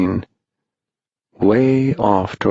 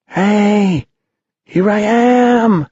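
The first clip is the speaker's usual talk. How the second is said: high, drawn-out call from far away; frail boy calling; 13 semitones higher, 4 dB louder, much slower than usual. A narrator's reading. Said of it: "called a frail voice".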